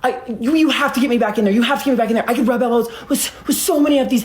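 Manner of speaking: high-pitched, stammering panicked rant; breathless-sounding mock pleading, pitched high; frantic fast talk. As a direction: mimic someone jabbering frantically. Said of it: "he's talking so fast".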